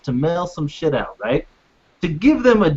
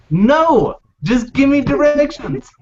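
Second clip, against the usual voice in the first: higher, slow, exasperated no; exasperated refusal; 6 semitones higher, 4 dB louder, slower than usual. Retold or imitated. Caller retelling snapping at the operator, exasperated. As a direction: push back hard on the operator, slowly.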